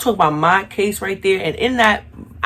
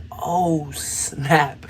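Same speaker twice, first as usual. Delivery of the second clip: whispered oh snap; 4 dB quieter than usual. Retold or imitated. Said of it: "So I'm like".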